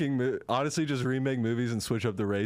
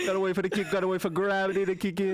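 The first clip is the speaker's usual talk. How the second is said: put-on Jamaican accent; 6 semitones higher, faster than usual. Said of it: "in Jamaican Inception".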